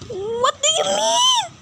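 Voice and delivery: mock outraged shriek; offended shout. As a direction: fire it back in outrage. Offended by insult to rap.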